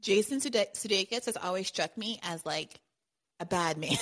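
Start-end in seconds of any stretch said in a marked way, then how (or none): none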